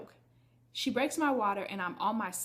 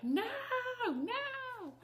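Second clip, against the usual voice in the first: refusing. Retold or imitated. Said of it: "I would just, like".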